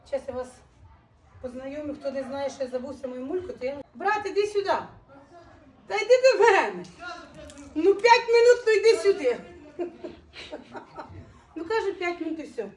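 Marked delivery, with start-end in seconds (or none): 3.9-9.4 laughing call. Real, not acted. Coaxing brother, laughing.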